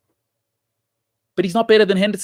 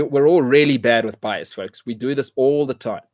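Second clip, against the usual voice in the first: normal talking voice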